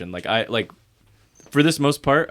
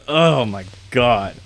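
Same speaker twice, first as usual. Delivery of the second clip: exasperated; 4 dB louder, slower than usual. Real, not acted. Venting exasperation about coworker.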